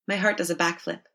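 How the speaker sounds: usual speaking voice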